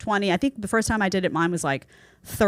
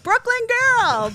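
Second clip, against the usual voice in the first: viewer quote; 4 dB louder than usual. Retold or imitated. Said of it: "people will write in and be like".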